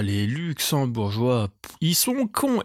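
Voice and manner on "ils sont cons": squeaky high jab; mock insult. Comic on a podcast, jokingly calling Luxembourgers dumb, high mock jab.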